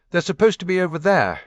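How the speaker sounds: loud, high, fast call across the grounds. A narrator's reading. Dorothy calls out to Lucinda, pointing loudly and fast. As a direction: sing out the direction, pointing the way.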